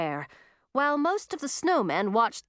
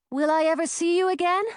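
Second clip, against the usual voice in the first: raised, higher call; 9 semitones higher, 4 dB louder, faster than usual. A narrator's reading. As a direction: call out across the distance. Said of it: "she called".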